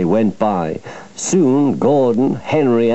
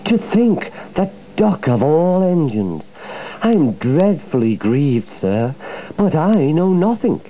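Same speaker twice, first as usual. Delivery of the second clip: high-pitched, put-on dismay of a sly engine; Diesel feigning innocence; 7 semitones higher than usual. A narrator's reading. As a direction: protest innocence with exaggerated grief.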